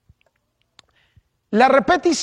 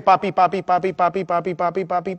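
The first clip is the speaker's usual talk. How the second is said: imitating a child; 3 semitones lower, 3 dB quieter than usual. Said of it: "si me llama mi hija".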